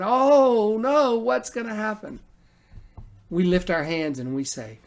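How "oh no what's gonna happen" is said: higher, louder alarmed "Oh no", trailing off; mock panicked yell. Preacher mimicking frightened people, half-serious panicked yell. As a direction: imitate the crowd panicking. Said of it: "everybody starts yelling and screaming and saying".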